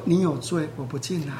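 low, very soft retold pronouncement; quiet verdict. Jesus telling the woman of her sin, hushed refusal. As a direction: drop right down and refuse.

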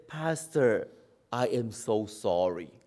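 low, soft, downcast apology; acted meek, sorry confession; mock contrite apology. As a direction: apologize quietly, sinking low. Acts the apology he rejects.

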